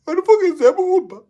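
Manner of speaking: high, loud outraged cry; outraged exclamation. Real, not acted. Vlogger telling viewers about the smelly Uber, loud and high. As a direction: blurt it out in disgust.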